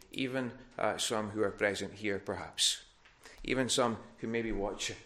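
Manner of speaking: ordinary talk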